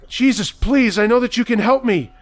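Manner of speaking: high, loud pleading cry to God; distant desperate yelling. Acted. Madman yelling for Jesus outside the store, desperate loud cry. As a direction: scream for Jesus to save you. Richard pleading, coming closer.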